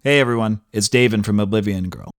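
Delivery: normal talking voice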